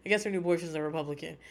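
ordinary talk, nothing marked

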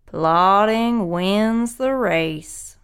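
very slow, deliberate tortoise. Acted. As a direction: draw it out, calm and sure. Tortoise's moral at end.

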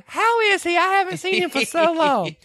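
very high, put-on innocent voice; Julia Roberts's character. Shelby teases Weezer about her grandson, very high voice. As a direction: sweetly ask after him, voice way up. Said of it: "and Julia Roberts goes".

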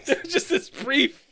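high, amused, laughing as you speak; talking through laughter. Real, not acted. Co-host pointing out the changed accent, laughing through the words. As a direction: tease while cracking up.

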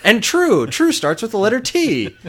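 bright sing-song letter game; Muppet voice. Retold or imitated. Muppet answers Doc with letters, high puppet voice. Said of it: "Ernie and Bert".

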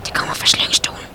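low, breath-only whisper; hushed whisper. Acted. Searchers tracing a sound in an empty building, whispering. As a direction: whisper warily while listening. Hushing to listen for a noise.

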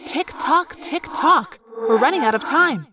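high impatient tick-tock; urgent taunt. Acted. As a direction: hurry them along, pointedly. Character urging hurry.

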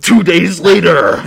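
loud, slow, drawn-out caption call; hammed-up announcer shout; time-skip announcer. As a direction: belt it out like a cartoon title card.

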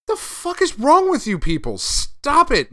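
high, loud mock Batman exasperation; a hammed-up furious Batman scolding his team; fed-up Batman. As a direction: pitch up and yell at the foolish heroes. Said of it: "And Batman is like".

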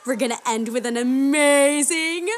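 singsong puppet show host. Acted. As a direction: pump up the kids like a children's entertainer. Grand finale announcement.

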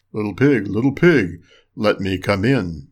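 low wolf voice, drawing out the last words; wolf at the door. A narrator's reading.

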